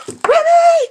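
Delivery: gasp, then a loud high shriek of the name; surprised squeal. Real, not acted. Finding what she hoped for in Ruby's box, gasping and shrieking. Discovering a special surprise gift.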